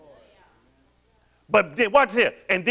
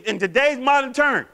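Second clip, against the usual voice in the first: usual speaking voice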